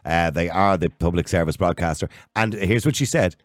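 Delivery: ordinary talk, nothing marked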